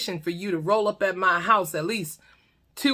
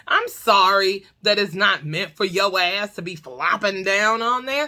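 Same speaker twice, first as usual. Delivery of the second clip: normal talking voice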